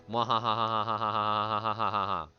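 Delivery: mad scientist cackle, low; evil laugh. Pretend. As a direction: cackle like a villain who can't be heard. Deafened, speaks to chat only.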